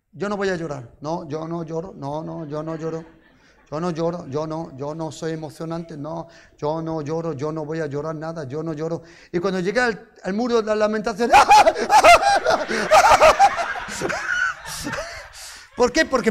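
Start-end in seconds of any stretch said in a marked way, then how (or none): 0.1-9.0 soft, lower, stubborn self-mocking mutter; quiet lowered insistence of a man pretending not to cry; mock tough denial. Mocks his own denial to wife. 11.4-15.2 high burst of real laughter; high, loud laughing burst; big loud laugh. Real, not acted. Laughs recalling Wailing Wall emotion.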